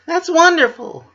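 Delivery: very high, loud bubbly squeal; chipper Pinkie. Acted. Pinkie answering Missus Cake, high and bubbly. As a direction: bounce it up bright and sweet.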